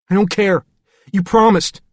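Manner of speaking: put-on high, loud, fast pleading child; a scared boy begging her, quickly; frightened little boy. A narrator's reading. David refuses.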